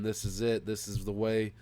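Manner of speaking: ordinary delivery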